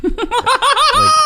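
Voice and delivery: laughing through the words; real laughter breaking through. Real, not acted. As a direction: giggle as you say it. Laughing at her book love.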